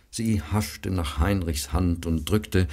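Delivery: ordinary delivery, nothing marked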